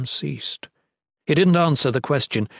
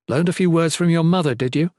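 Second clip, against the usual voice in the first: ordinary delivery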